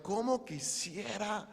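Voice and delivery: high, slow, drawn-out plea; understated acted weeping, no sobs; mild tearful lament. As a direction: stretch it out as longing. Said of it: "Tal vez Jesús llorando".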